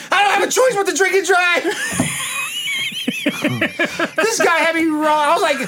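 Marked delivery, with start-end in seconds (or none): 0.1-2.0 retelling a stranger's line while cracking up; laughing while quoting. Retold or imitated. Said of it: "He goes". 2.1-4.1 very high, breathy, helpless laugh; wheezing cackle; high shrieking laugh. Real, not acted. Cracking up at retold story.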